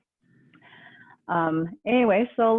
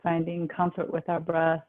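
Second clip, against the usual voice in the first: calm, even, unhurried relaxation guide; guided meditation; 3 semitones lower than usual. Real, not acted. Yoga teacher guiding the class into relaxation, calm guided meditation.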